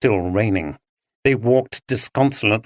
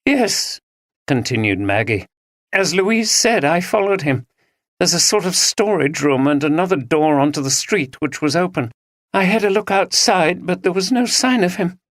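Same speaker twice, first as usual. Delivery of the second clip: lightly voiced young woman, pitched higher, shaken; distressed young woman; 8 semitones higher than usual. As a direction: Maggie telling the police, voice lifting and breaking at the end. Maggie continues her account.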